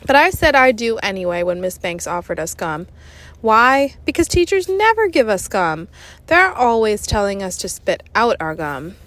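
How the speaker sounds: plain reading voice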